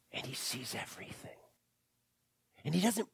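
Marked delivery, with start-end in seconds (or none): 0.1-1.3 hushed, quick whisper. Acted. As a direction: drop to a secret whisper for effect. Sermon climax, dropped to whisper.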